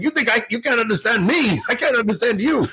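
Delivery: high-pitched mock indignation; imitated indignant priest. Imitating the Scottish priest's comeback to him, indignant. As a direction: throw the complaint right back, hammed up. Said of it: "He's like".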